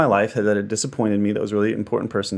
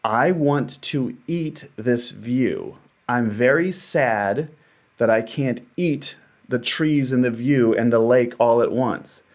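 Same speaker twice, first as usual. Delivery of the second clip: slow wistful longing; slower than usual. Retold or imitated. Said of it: "I was like".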